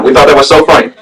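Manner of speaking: ordinary talk, nothing marked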